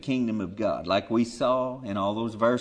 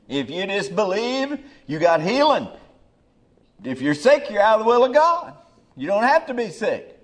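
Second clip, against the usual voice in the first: mock prosperity preacher; 8 semitones higher, 7 dB louder than usual. Mocking prosperity preachers' claims.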